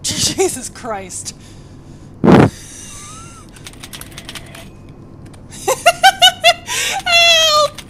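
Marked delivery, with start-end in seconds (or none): 1.4-7.0 loud bursts of very high nervous laughing; high panicked laughter. Real, not acted. Scare in horror game. 7.0-7.8 very high, loud cry for help; screamed plea. Real, not acted. Scared, cries for help.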